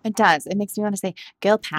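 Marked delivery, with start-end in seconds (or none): 1.4-1.8 put-on British accent. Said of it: "that's my British accent".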